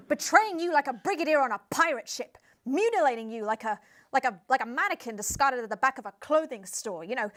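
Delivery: ramp it up melodramatically; hammed-up dramatic build; escalating rant. Guest escalating metaphors.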